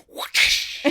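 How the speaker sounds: breathy whoosh of a whip; unvoiced whoosh. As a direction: make the real whip sound. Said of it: "the real whipping noise".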